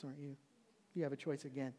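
ordinary delivery

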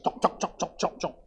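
rapid, rhythmic mock chomping; imitated chewing noise. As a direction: mimic noisy eaters with evenly repeated chomps. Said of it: "all we hear in the front".

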